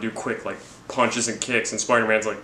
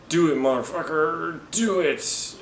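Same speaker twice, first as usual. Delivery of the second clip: higher, pushing dare; mock defiant dare; 6 semitones higher, slower than usual. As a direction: egg him on in a hammed-up voice. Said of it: "he's like".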